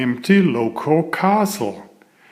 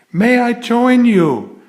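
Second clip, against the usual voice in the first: put-on higher and louder small boy voice; a little boy eagerly calling out; small boy crying out; 10 semitones higher, 4 dB louder than usual. A small boy asks the riders to join, in a high loud child voice. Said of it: "cried a small boy".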